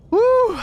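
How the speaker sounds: loud, high whoop. Real, not acted. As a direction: let out a sudden high "woo". Whoops while reading fan messages.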